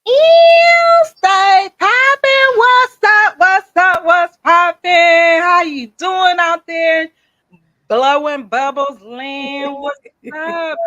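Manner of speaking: high, loud, stretched-out hype shout; hyped greeting. Acted. Host hyping up the podcast audience, loud drawn-out greeting. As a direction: yell it to the crowd like a hype host.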